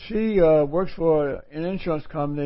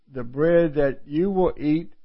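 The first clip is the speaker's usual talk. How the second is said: usual speaking voice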